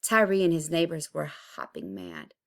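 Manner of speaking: plain reading voice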